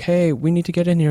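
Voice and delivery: high-pitched mock employee call. Employees outside the change room, calling in with a high mock voice. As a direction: pitch it up like staff knocking at the door. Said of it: "the employees were like".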